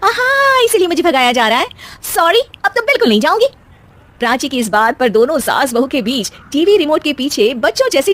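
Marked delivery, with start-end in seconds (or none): 0.0-3.5 much higher, defiant and teasing; cheeky daughter-in-law. Acted. Daughter-in-law refusing to give up the TV, cheeky.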